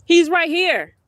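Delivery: high, loud call that drops at the end. Real, not acted. Telling daughter the dog is here, calling out. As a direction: holler it to someone far off.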